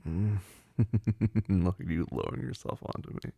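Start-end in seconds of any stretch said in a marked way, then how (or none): 0.8-1.6 loud run of chuckles; teasing, open laugh; amused chuckle. Acted. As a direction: laugh in quick, even pulses. Amused in partner roleplay.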